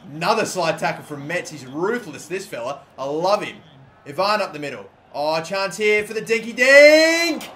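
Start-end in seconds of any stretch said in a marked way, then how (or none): none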